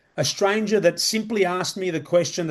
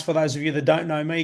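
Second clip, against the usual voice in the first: ordinary talk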